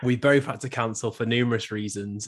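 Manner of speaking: normal talking voice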